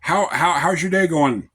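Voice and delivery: friendly greeting. Retold or imitated. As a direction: imitate a once-rude customer now greeting you happily. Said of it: "he's like".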